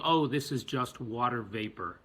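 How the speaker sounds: ordinary talk, nothing marked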